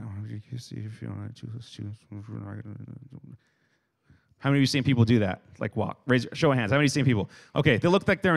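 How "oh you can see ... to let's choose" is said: very soft, mumbled self-talk; muttering under breath. Retold or imitated. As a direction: imitate someone lost in thought, barely audible. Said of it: "mutter under their breath".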